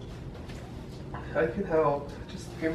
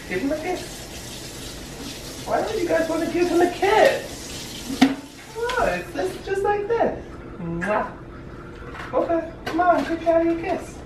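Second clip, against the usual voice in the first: put-on baby talk to pets; 9 semitones higher, 4 dB quieter than usual. Coaxing the cats, high pitch.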